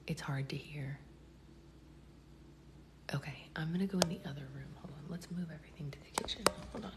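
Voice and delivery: whispering, moving rooms; quick hushed whisper; quiet at night. Real, not acted. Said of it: "Secret Boyfriend is sleeping".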